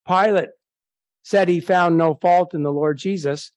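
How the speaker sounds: usual speaking voice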